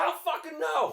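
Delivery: very high, loud mock outburst; imitated exasperated yell. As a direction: yell it as the baffled boss. Boss's exasperated reply, acted.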